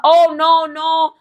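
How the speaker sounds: high, flustered stammering excuse; imitating a caught woman stammering to the girlfriend; shocked backpedaling. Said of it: "Linda is shocked".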